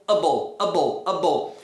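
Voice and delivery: low, weak "able" said as "uh-bol"; reduced ending. Shown as a demonstration. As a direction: say "able" as an unstressed uh-bl. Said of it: "É sempre um o".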